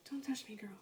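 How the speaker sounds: usual speaking voice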